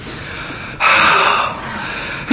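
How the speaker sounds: long, soft acted sigh; heavy self-pitying sigh. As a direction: let out a long poor-me sigh. Acting out self-pitying thoughts.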